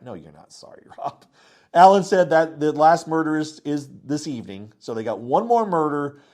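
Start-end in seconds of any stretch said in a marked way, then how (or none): 0.0-1.2 much softer, a laughing dismissive retort; teasing Rob under her breath; quiet scoff. Retold or imitated.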